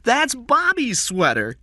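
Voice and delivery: put-on slow, very high child's voice; protesting boy. A narrator's reading. Jeff explains the sweater to Cy, protesting. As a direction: explain loudly in a high boyish pitch.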